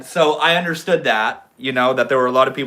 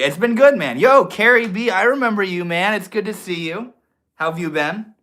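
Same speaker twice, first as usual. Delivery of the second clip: excited greeting; 8 semitones higher, 5 dB louder than usual. Real, not acted. Excited greeting of returning viewer.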